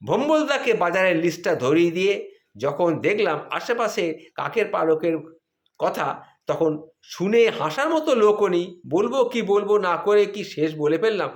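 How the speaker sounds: ordinary talk, nothing marked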